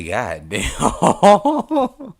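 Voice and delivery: drawn-out god damn. Retold or imitated. Streamer telling chat his reaction, drawn-out amazed. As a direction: let the damn hang in mock astonishment. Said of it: "I'm about to say".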